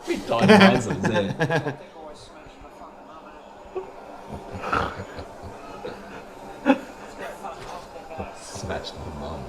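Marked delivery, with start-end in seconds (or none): 0.1-1.7 laughing. Real, not acted. Laughing while telling. 1.8-8.4 breathless laughter. Real, not acted. Long laugh fit.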